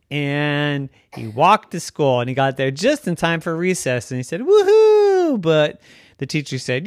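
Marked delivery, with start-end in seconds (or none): 4.4-5.4 high, loud "Woohoo" of delight; joyful cheer. Acted. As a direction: whoop with glee at recess.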